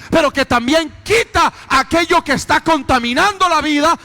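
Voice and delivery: loud, ringing sermon voice; full-force preaching. Acted. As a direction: proclaim it to the congregation.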